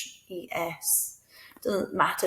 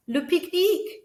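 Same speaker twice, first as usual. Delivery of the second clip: "Le pique-nique" sung out much higher as a question; rising question; 11 semitones higher, 4 dB louder than usual. Shown as a demonstration. Teacher modelling "Le pique-nique" as a high rising question. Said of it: "just make your voice go up and it makes it a question".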